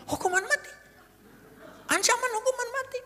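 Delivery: high, breathless whisper of disbelief; shocked whisper. Retold or imitated. Cellmate hears of the death sentence, whispering in shock. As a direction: gasp the words out in a stunned hush. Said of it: "Mulai bisik-bisik dia".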